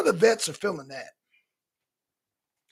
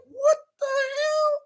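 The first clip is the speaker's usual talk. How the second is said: can't believe what you're seeing; shocked disbelief; slower than usual. Retold or imitated. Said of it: "I'm like".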